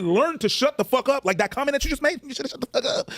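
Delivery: retold scolding. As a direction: lay down the law, voice pitched high. Retelling own scolding of a talker.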